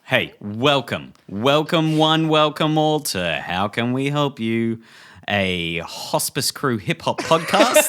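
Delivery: slow, stretched-out host welcome; show intro. Real, not acted. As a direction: welcome everyone grandly, drawing out the words. Delivers the podcast's opening welcome.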